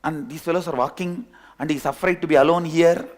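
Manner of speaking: normal talking voice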